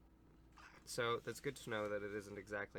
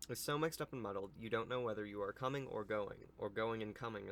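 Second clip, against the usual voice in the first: louder storybook read-aloud; story narration; 6 dB louder than usual. Acted. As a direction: read it out to children, projected.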